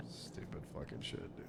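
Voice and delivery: whispered swearing under breath; muttered curse. Real, not acted. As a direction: grumble it barely voiced.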